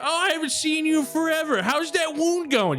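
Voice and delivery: very high, eager little voice, slowing at the end; excited halfling. Acted. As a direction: fuss over a friend in a squeaky voice.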